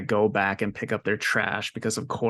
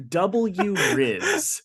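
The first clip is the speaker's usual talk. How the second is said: higher, mocking kid's "W Riz"; 10 semitones higher than usual. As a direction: parrot "W Riz" in a higher, sneering kid voice. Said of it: "says that out loud".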